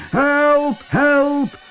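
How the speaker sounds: high-pitched cries for help; calling out. A narrator's reading. Soldiers calling for help as the prisoners escape, high-pitched cry. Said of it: "riepen de soldaten".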